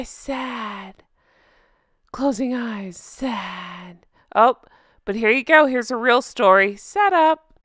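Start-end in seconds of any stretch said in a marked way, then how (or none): 0.0-1.0 soft, quiet, droopy mock-sad sigh of a word. Mocking her staged sadness. 3.0-4.1 soft, quiet, droopy mock-sad sigh of a word. Mocking her staged sadness.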